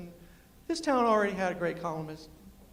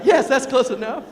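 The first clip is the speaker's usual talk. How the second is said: loud, higher, triumphant yes; 4 semitones higher, 13 dB louder than usual. Retold or imitated. Speaker answers the linguistics professor, loudly triumphant. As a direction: cry out in delighted victory.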